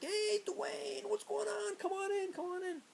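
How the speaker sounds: very high put-on welcoming voice; jolly priest greeting. Priest waving the boys into his house, falsetto cheer. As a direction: sing it out like an overly friendly host. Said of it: "and he's like".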